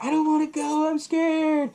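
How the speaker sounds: high, whiny dumb-blonde voice; mocking Misty refusing to go; ditzy scared blonde. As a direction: whimper it high like a helpless airhead. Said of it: "the dumb blonde character".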